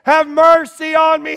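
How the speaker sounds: high full shout, slow. Acted. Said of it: "he shouted all the more".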